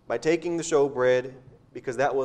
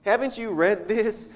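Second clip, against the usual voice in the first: incredulous question; 6 semitones higher, slower than usual. Retold or imitated. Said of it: "he starts".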